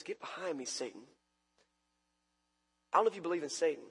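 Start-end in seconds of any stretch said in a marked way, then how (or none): 0.0-1.1 soft, low, firm rebuke; Jesus gently but firmly rebuking Peter; quiet rebuke. Acted. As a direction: say it quietly to a dear friend. In all his humanity, looks at Peter.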